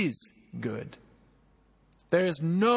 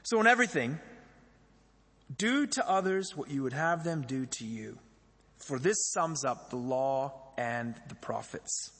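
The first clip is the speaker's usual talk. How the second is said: scripture reading; 5 semitones lower, 3 dB quieter than usual. Acted. Reads final verse.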